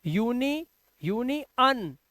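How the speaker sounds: यूनि, then अन as a separate short 'un'; syllable-by-syllable 'un'. Shown as a demonstration. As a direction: split यूनि and अन for learners. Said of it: "आ का उच्चारण निकालो ना".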